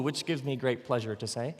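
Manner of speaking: ordinary delivery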